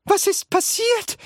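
put-on very high, startled old-lady cry; calling out. A narrator's reading. Floating grandma calling out in alarm. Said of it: "rief Grandma Josephine".

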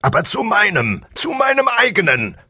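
very high strained king voice; roaring tyrant king. A narrator's reading. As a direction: shriek it in royal rage. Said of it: "brüllte der König". (brüllender tyrannischer König)

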